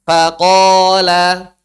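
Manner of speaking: Faqala with thin fa, heavy qaf, thin lam; slow, loud Faqala with only qaf thick. Shown as a demonstration. Teacher models Faqala slowly for students to copy. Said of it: "Jadi baca gini".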